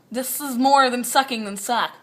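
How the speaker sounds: ordinary talk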